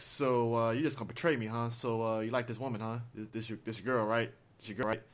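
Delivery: quiet, low, menacing taunt; a hushed villain toying before he shoots. Retold or imitated. Quaylen to Rex, a quiet threat. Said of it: "looking at him like".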